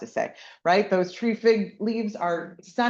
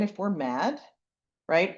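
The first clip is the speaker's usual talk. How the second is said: ordinary talk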